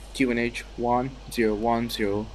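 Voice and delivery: ordinary talk, nothing marked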